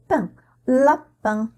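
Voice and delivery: "pain, lapin" built up slowly from the syllable; syllable-by-syllable demo. Shown as a demonstration. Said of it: "P I N". ("pain, lapin", lentement, syllabe puis mot)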